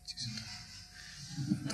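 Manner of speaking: barely audible, drawn-out whisper; secretive female-voice whisper, imitated; whispered beckoning. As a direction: whisper it like a woman luring a stranger closer. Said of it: "которая тебя шёпотом под-, подзывает".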